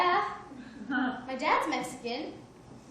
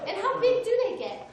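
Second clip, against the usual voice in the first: high, loud, laughing wonder; giddy excitement; 7 semitones higher, 5 dB louder than usual. Retold or imitated. Asking friends about her first snowflake, high laughing excitement. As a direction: gush over the snowflake like a thrilled kid.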